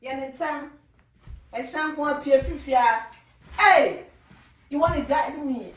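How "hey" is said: loud scolding call; sharp shout. Acted. Wife scolding husband, sharp shout. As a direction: shout to pull someone up short.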